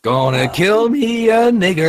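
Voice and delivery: high, loud, slow Southern drawl; mock Southern accent. Parodying the supposedly friendly Southern accent, high drawl. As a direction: draw it out in a hammed-up Southern accent. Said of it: "your attempt at a Southern accent".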